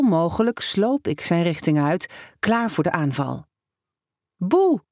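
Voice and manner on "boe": shouted boo. A narrator's reading. Kid jumping out at a boy to scare him, shouting. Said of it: "schreeuwde ik".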